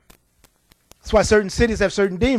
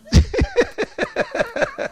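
high laugh. Real, not acted. Preacher laughs at the story.

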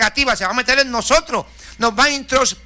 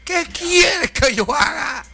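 much higher, slow crying cry on "quieres"; trembling fearful plea; 8 semitones higher, much slower than usual. Retold or imitated. Trembling Saul begs the Lord, crying and slow. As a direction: plead slowly in a tearful, frightened cry. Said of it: "él temblando y temeroso dijo".

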